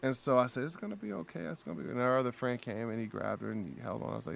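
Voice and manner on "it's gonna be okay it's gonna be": soft, high, tender reassurance; soothing. Retold or imitated. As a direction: hush it gently to comfort someone trembling. Soothing a shaking, emotional fan.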